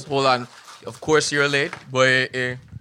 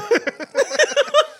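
laugh; 5 dB louder than usual. Real, not acted. Laughs after retold huh.

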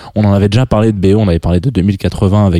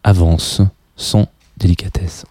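a hint of a hushed wildlife narrator; mock nature documentary narrator; slower than usual. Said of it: "un mec qui parle doucement".

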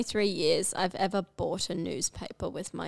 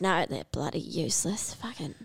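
retold grandmother in a whisper; 5 semitones lower, 10 dB quieter than usual. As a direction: whisper it like a grumbling old granny. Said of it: "my grandmother goes".

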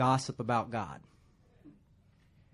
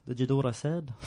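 put-on unintended Liverpool accent. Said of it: "He's a Beatle now".

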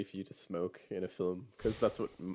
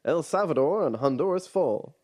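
high, loud newsreel announcer read; old-timey radio voice; 6 semitones higher, 5 dB louder than usual. Shown as a demonstration. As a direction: announce it like a 1940s radio bulletin. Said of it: "Old-timey radio voice".

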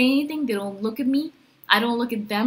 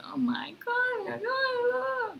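high, drawn-out mock wail; hammed-up emotional meltdown, pitched way up; mock overemotional; 14 semitones higher, 5 dB quieter, slower than usual. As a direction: wail in exaggerated distress, softly. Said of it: "other people will be emotional".

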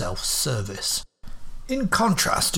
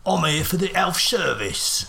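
slow, higher Cockney voice dropping the h in health; disguised cockney; 5 semitones higher, slower than usual. Acted. Holmes in disguise talking to the guard, Cockney. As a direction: play a common Cockney patient.

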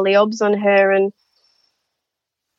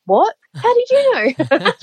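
high, slow, stunned "how did you know"; as if the listener read her mind; astonished colleague; 9 semitones higher than usual. Retold or imitated. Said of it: "And she went".

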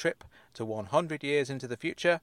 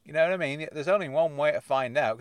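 ordinary talk

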